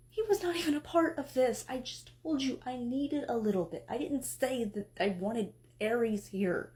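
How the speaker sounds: ordinary talk, nothing marked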